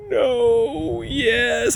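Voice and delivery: high, wobbly mock sob of a scared kid; imitating crying girl. Dad retells daughter's answer after go-kart crash, mock crying. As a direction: squeak it out like a tearful little girl.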